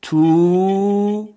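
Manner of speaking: تو with a long, held oo; elongated vowel. Shown as a demonstration. Teacher showing the sound او, تو drawn out. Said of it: "صدای او".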